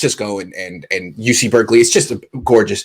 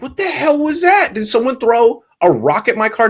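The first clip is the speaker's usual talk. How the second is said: much higher, alarmed cry of disbelief; jolted, demanding to know what hit the car; high startled shout; startled outburst; 12 semitones higher than usual. Retold or imitated.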